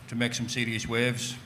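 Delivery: usual speaking voice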